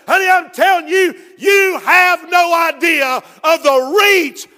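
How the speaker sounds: loud, high, slow preaching shout; full-force preaching. Real, not acted. Preacher drives home the grace of God to the church.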